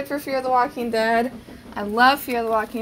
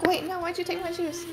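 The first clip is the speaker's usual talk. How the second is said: lightly voiced little kid, a bit higher and softer; voicing toddler; 7 semitones higher, 6 dB quieter than usual. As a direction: give the kid's protest in a light, higher voice. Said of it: "She's like".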